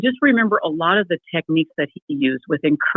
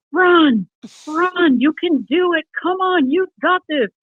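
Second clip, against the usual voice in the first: imitated coach yelling; 10 semitones higher, slower than usual. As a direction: call out like a gym instructor pushing runners. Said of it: "yelling at the clients".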